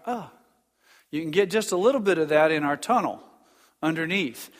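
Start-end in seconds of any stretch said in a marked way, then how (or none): 0.0-0.3 short, high, soft imitated echo. Shown as a demonstration. Preacher imitating the cave's answer, faint echoed "ah". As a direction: softly voice the call bouncing back. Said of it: "then it'll come back".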